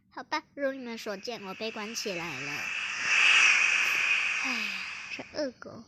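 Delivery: ordinary talk, nothing marked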